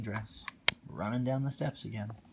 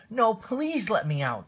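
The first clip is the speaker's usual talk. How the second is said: higher, louder locked-in plea; pleading cry; 12 semitones higher, 9 dB louder than usual. Acted. Cinderella locked in the attic, crying out to be freed, higher and louder. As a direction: beg to be let out. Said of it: "cried Cinderella".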